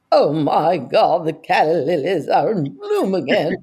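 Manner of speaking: slow, quavering grande-dame impression; Katharine Hepburn. Comedian showing her audition character, slow theatrical impression. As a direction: draw it out theatrically with a wavering voice. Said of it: "I used to do Katharine Hepburn".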